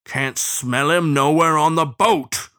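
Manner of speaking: drawn-out baying bloodhound; furious howl. A narrator's reading. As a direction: howl it out in frustration. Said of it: "furious howl creeping into his sepulchral voice".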